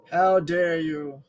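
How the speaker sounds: slow, drawn-out pompous voice speaking for the dog; mock grand dog voice. Voicing his dog at the doorbell, slow and mock-haughty. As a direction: play the indignant dog, stretching every word.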